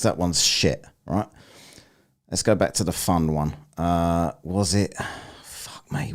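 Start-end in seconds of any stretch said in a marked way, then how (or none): 5.4-6.1 unvoiced muttered curse; whispered curse. Real, not acted. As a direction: hiss it under your breath.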